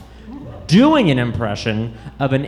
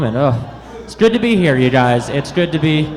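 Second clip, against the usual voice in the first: usual speaking voice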